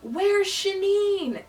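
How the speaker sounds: high, loud, drawn-out holler; imitated screaming. Guard asking a coworker across a noisy stadium, mock screaming. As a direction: stretch the name out as a mock stadium yell. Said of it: "he was really screaming my name".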